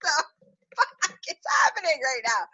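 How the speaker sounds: high-pitched, incredulous retold outburst; what-on-earth disbelief, voice leaping high; shocked disbelief. Acted outraged reaction in her story.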